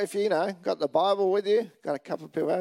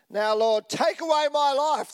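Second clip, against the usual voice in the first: higher, hammed-up despair; desperate Jonah; 6 semitones higher, 4 dB louder than usual. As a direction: plead dramatically to die. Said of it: "This is how upset he was".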